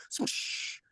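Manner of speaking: whooshing hiss of breath; soft voiceless splash noise; imitated water splash. Imitates dumping water on her.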